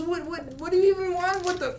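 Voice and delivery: put-on high, rattled kid voice; a spooked child sputtering at the stranger; startled kid. Frightened kid demands what the stranger wants, high and rattled.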